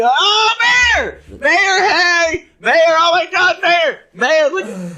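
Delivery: high, loud yelling like a starstruck fan; wildly excited. Retold or imitated. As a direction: imitate a fan screaming for attention. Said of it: "This guy was so excited".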